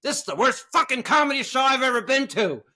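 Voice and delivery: high, loud complaint in a woman's voice; hammed-up indignant rant; mock angry patron. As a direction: imitate the fed-up woman storming out. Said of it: "she's like".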